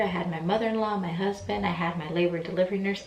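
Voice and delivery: normal talking voice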